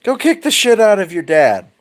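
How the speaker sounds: high, singsong mom voice giving an order; mother's voice. Retold or imitated. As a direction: cheerfully urge the kid into violence. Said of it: "Absolute youngest is like".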